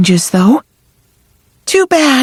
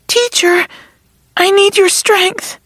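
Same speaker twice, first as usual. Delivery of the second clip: pleading; 6 semitones higher than usual. Acted. Fran pleading with her sword.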